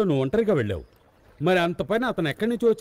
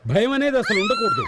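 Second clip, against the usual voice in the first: startled shout; 7 semitones higher than usual. Acted. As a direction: yell out suddenly. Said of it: "ఎందుకు అరిచావు?". (గట్టిగా అరుపు)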